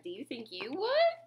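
ordinary delivery, nothing marked

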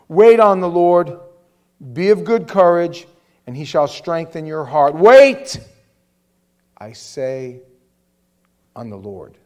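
loud, slow proclaiming of the verse; preached scripture. Acted. Preacher calling the church to wait on the Lord, booming. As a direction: declare it to the congregation.

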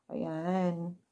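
hands pressing at nose; speaking while adjusting mask; talking in sheet mask. Real, not acted. Seen 0: Fingers press mask at nose; lips stay visible, mask covers face.

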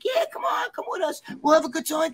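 very high put-on girl voice; imitated coaxing girl. Date urging him to come along, high-pitched coaxing imitation.

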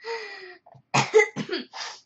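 a real dry cough. Real, not acted. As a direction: cough hard once. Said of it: "I'm coughing so much".

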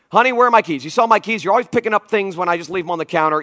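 loud, annoyed demands, rushing at the end; barking at family. Retold or imitated. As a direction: snap at the family, hunting for the keys. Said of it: "I begin barking at everybody".

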